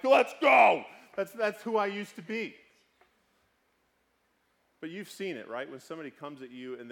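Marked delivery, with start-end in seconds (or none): 0.0-0.8 loud, high fighting shout; acted challenge, yelled like picking a fight; matching their anger. Hothead wanting to match an angry person, combative yell. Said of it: "you wanna match them".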